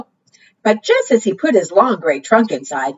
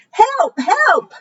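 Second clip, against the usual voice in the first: high, frantic cry for help; panicked cry; 14 semitones higher than usual. Acted. Frightened Rabbit cries for help from his burrow, high and panicked. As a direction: squeak out a scared cry for help. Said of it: "cried Rabbit".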